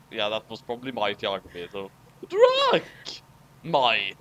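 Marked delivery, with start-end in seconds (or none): 2.3-2.8 sharp, high shouted name; character shout. Acted. Character yells the name across the battle. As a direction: bark the name out.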